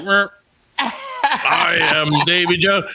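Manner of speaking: slow stiff animatronic pirate; cheap robotic doll voice. Retold or imitated. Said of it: "it's like a doll".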